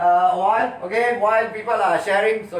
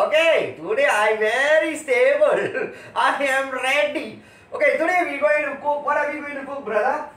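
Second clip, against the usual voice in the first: excited show host; 8 semitones higher than usual. Acted. Host opening the cooking show.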